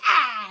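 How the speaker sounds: loud mock monster roar; a sudden loud goblin shout; a hammed-up villain yell. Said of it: "turns into Green Goblin, and it's all like".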